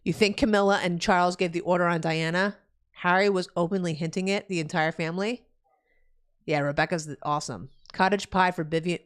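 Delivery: ordinary talk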